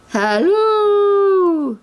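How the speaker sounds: high, loud, drawn-out holler; sing-out hello into the distance; long calling-out. A narrator's reading. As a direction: call out with a long held last note. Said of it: "called a voice not very far away".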